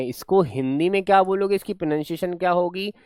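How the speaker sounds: normal talking voice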